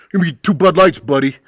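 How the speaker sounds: ex-husband ordering beers, condescending; demeaning. Retold or imitated. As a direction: talk down to the bartender. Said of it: "More of a demeaning buddy".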